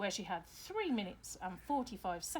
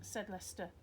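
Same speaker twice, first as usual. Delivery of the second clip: ordinary delivery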